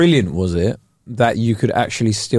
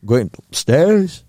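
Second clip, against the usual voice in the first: put-on Yorkshire accent; 3 semitones higher than usual. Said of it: "I think the Yorkshire accent is quite cool".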